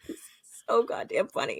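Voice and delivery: breathless laughing through the words; can barely get it out for laughing. Real, not acted. Laughing to the co-host at the funny scene, breathless laughter.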